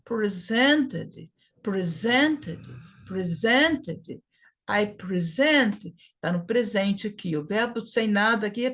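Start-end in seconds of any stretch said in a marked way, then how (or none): 0.0-4.2 Presented as pre-ZEN-ted, middle syllable punched; stress on second syllable. Shown as a demonstration. Teacher drilling the past tense, second syllable stressed. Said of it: "Continua a mesma coisa". 4.7-5.9 slow down and weight ZENT in present; stress on last syllable. Shown as a demonstration. Teacher modelling the present-tense verb, last syllable stressed. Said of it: "na última sílaba".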